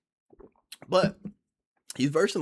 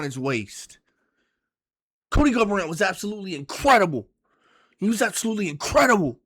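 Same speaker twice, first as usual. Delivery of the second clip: louder, higher and fired up; heated rant; 4 semitones higher, 4 dB louder than usual. Real, not acted. A fan raging at his champion's cheated loss, loud and angry. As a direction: let the outrage boil over.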